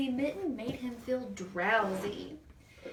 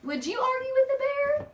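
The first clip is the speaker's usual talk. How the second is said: much higher, louder playful question; excited question; 7 dB louder than usual. Acted. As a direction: ham it up to thrill the child. Teacher hams up question to child.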